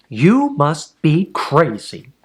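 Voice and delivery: slow, drawn-out disbelief; scandalised neighbour. Acted. Mrs Hasselbeck tells Mother off, slow and scandalised. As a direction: lean on each word in outrage.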